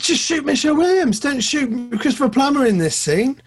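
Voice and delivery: high-pitched mock exasperation; acted exasperation, high and insistent; exasperated pleading. Imagined exasperated advice to film-makers.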